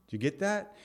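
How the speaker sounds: ordinary delivery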